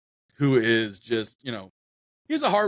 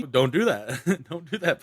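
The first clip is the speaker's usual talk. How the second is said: slow telling off through laughter; half-joking don't do that; laughing scold; 4 semitones higher, 3 dB louder, slower than usual. Retold or imitated. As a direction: scold them but you can't stop laughing. Laughing while scolding cousins.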